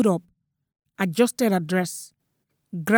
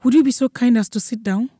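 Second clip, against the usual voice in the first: raised, louder, anxiously polite request; flustered, over-courteous plea; nervous stammer; 4 semitones higher, 6 dB louder than usual. A narrator's reading. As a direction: ask politely while rattled, pitched up. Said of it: "he stammered".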